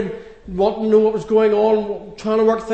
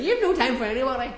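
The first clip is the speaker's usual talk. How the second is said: higher, quicker imitation of a nagging mum; mum scolding; 6 semitones higher, faster than usual. Preacher mimicking his mum's complaint, higher and quick. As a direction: toss it off like mum telling you off. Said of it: "My mum would say".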